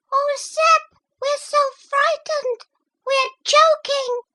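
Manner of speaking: very high, thin, sharp little voices crying for help; thin sharp mussel voices. A narrator's reading. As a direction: squeak out in panic like tiny shellfish. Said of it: "voices were thin and sharp".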